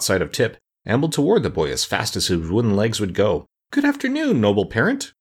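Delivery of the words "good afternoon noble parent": high-pitched, delighted greeting; delighted cry. A narrator's reading. Pumpkinhead greets his noble parent, crying out delightedly. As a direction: open very high with delight, then settle. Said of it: "he cried delightfully".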